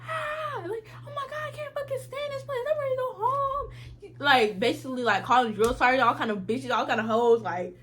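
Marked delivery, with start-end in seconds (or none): 0.1-3.7 very high, wailing mock scream; screaming meltdown. Recruit screaming upstairs that she wants to go home, mock wailing scream. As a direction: wail it like a recruit losing it. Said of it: "She was screaming".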